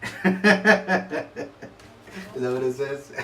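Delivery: asked through real laughter; laughing. Real, not acted. Amused dad laughing at his daughter's card, laughing question.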